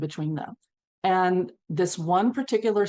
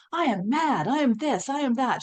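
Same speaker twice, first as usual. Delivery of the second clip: imitating an exploding client, pitched higher; retold angry outburst; 7 semitones higher than usual. Horsewoman retells her client exploding in anger, imitated higher-pitched outburst. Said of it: "Jill exploded. That anger".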